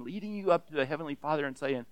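ordinary talk

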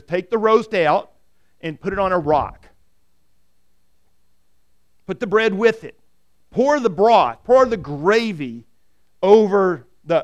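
loud, slow, deliberate orders; commanding. Acted. Angel of the Lord instructs Gideon, slow and loud. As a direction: give each command with weight.

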